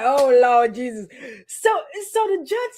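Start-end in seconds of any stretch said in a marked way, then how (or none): 0.0-1.1 exasperated aside. Retold or imitated. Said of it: "And I was like".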